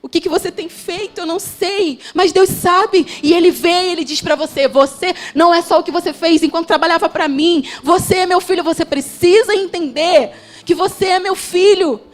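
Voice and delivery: loud, climbing, fervent confession of sins; impassioned preaching. Acted. Preacher crying out to the congregation, loud and fervent. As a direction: preach it with rising fire.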